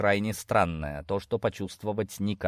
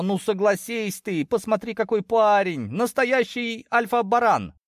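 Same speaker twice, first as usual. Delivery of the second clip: high-pitched, drawn-out coaxing; insistent father; 12 semitones higher, 3 dB louder than usual. A narrator's reading. Father talking up the young man to daughter, insistent. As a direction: coax eagerly, stretching the words. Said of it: "не унимался отец семейства".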